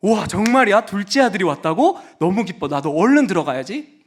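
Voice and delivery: bright, loud joyful reaction; happy excited son. Acted. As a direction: burst out with glad surprise. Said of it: "첫 번째".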